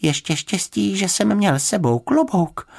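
high little bear voice, mildly winded; lightly acted breathlessness, pitched high; gasping for breath. A narrator's reading. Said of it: "lapal po dechu".

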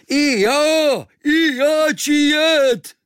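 very high, slow, loud islander's cry; Kvíkvék eagerly shouting to join the crew. Acted. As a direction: shout it out, begging to come.

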